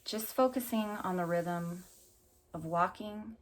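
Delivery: unhurried meditation voice with long pauses; calm, spacious guided-visualization delivery. Acted. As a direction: guide them gently through an imagined walk, leaving space between phrases. Leads a walking meditation.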